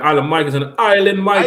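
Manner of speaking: playful hint of a Jamaican accent for a friend's name; hammed-up Jamaican lilt. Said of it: "using our Jamaican accents".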